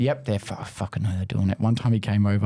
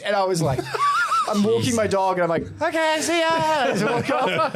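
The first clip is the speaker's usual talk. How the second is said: laughing through the words, pitched high; can't stop laughing while telling it; 14 semitones higher than usual. Real, not acted. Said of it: "laughing while retelling".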